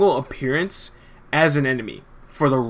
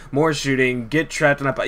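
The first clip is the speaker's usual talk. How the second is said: ordinary talk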